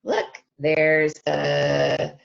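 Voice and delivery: plain reading voice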